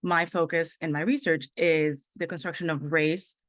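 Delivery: ordinary talk, nothing marked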